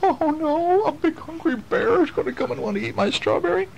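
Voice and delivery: high, squeaky mock panic; worried mouse. The mouse frets to the children in a high squeak. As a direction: fret like a tiny scared mouse. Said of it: "He's going".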